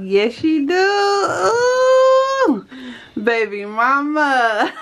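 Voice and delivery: high, drawn-out cooing cheer; playful hyping. Real, not acted. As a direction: hype up your friend, stretching it high. Hypes up friend's makeover.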